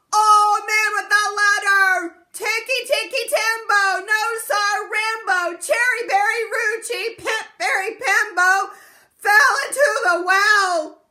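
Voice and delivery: very loud, high, last-breath shout. Acted. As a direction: pour the last breath into one big yell. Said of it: "he shouted".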